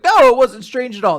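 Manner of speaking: high, loud imitated denial; acted over-eager reassurance; hasty reassurance. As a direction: blurt out a mock-flustered denial. Sid hurriedly reassures flustered Lulu.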